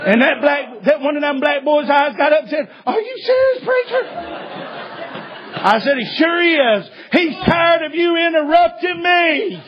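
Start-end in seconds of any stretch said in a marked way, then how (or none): 2.9-4.0 put-on high, cracking cry of a scared boy; imitating a terrified teen pleading; frightened boy. Frightened boy asks preacher. 6.0-9.6 laughing retort. Retold or imitated.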